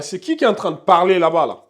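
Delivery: ordinary talk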